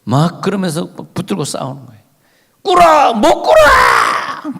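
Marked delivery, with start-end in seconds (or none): 2.6-3.1 high, loud order; commanding shout. Acted. The angel ordering Jacob down, shouting. Acted angel demands kneeling. 3.1-4.6 high, loud and drawn-out refusal with a sob; crying shout. Acted. Jacob refusing to kneel, crying and shouting.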